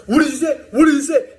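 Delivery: high, loud mock guard demanding; imitating the guard squaring up to him; mock confronting guard. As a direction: bark it up high like a petty guard.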